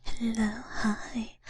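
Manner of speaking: ordinary talk, nothing marked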